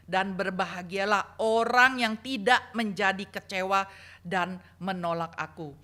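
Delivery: loud, ringing Bible reading; scripture reading. Acted. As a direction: proclaim the passage to the congregation. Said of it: "Saya bacakan secara cepat".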